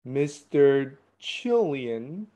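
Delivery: usual speaking voice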